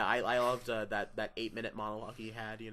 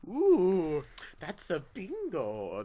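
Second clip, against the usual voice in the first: slow, drawn-out delighted "bingo"; Christoph Waltz impression; 6 semitones higher, 4 dB louder, much slower than usual. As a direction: savor each word like Hans Landa. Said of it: "That's like Christoph Waltz".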